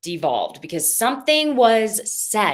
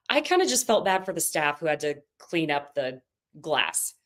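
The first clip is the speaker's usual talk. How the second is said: usual speaking voice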